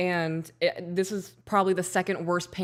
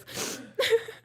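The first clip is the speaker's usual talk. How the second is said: breathless laugh. Real, not acted. Laughing fit.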